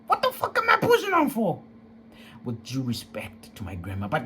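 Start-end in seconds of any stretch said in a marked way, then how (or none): 0.1-1.6 much higher, exploding angry shout; furious. Real, not acted. Young man raging about being told to endure, much higher. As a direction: let the anger break loose. Angry outburst at grandma's advice.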